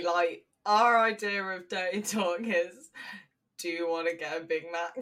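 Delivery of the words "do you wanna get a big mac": quick line through laughter; laughing. Retold or imitated. Telling a friend their idea of dirty talk, laughing. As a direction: rattle it off while cracking up.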